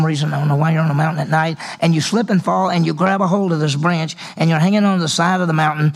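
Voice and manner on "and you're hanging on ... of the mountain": ordinary talk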